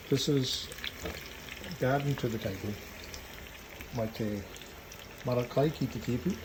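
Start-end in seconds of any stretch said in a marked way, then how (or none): none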